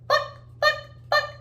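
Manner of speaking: very high, short staccato bucks; chicken clucking. Shown as a demonstration. Teacher playing the chicken sound, high staccato clucks. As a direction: cluck like a hen in tiny squawked beats. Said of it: "The chicken can say cluck or buck".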